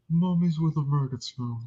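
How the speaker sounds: lightly imitated movie demon. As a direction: slip into a mock demon voice. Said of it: "like, a demon voice where she goes".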